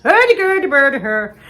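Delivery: loud mock Swedish Chef gibberish; hammed-up Muppets chef babble. Joking to Caroline as the Muppets chef, loud mock Swedish gibberish. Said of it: "I'm the Swedish chef from, um, Muppets".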